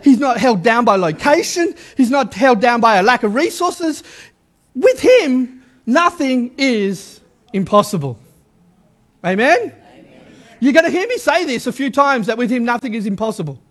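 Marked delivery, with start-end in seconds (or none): none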